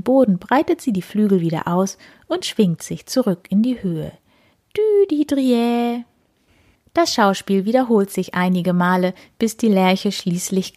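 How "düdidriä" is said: high, slow sung bird trill; birdsong call. A narrator's reading. Lark sings in the air.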